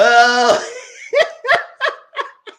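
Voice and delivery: loud, high burst of laughter; real cackling laugh. Real, not acted. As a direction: crack up loudly. Real laugh after shouting boo.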